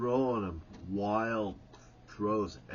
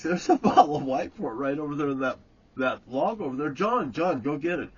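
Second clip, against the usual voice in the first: louder, fast, half-laughing; louder urging; 3 semitones higher, 7 dB louder, faster than usual. Retold or imitated. Jerry sending John for the bottle, loud and fast. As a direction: hurry the kid along with a chuckle.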